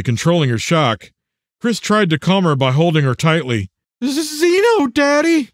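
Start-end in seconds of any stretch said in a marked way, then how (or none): 4.0-5.5 put-on very high little-girl voice; distressed daughter. A narrator's reading. Shocked daughter answering her father, high child voice.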